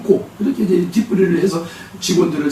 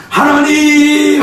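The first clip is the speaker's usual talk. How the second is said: long drawn-out high wail of 하나님; wailing cry; 11 semitones higher, 7 dB louder, much slower than usual. Retold or imitated. Mother crying out to God at the prayer house, wailing. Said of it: "하고 울부짖었겠죠".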